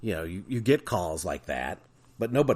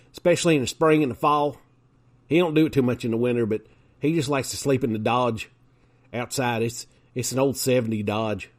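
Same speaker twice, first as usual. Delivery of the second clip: lightly voiced country bumpkin, higher and louder; 5 semitones higher, 3 dB louder than usual. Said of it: "I'd go".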